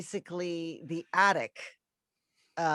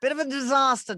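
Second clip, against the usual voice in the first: loud, higher theatrical lament; hammed-up posh voice; 7 semitones higher, 8 dB louder than usual. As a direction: play it as a grand diva. Mock posh self-deprecation.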